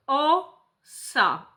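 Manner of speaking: slow, each word drawn out syllable by syllable; slow syllable demo. Shown as a demonstration. Therapist showing a child how syllables join, said slowly. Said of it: "gdy dziecko łączy sylaby".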